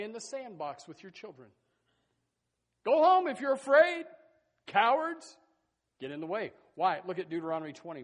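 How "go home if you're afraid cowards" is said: much higher, much louder shout; full-force preaching shout. Acted. Preacher shouts at the cowards to go home, full force. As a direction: blast it out to the back row.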